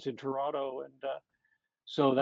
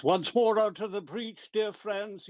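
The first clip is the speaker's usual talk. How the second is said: theatrical English accent, higher and louder; 6 semitones higher, 4 dB louder than usual. Shown as a demonstration. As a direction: declaim it like a British stage actor. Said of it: "You need that English accent".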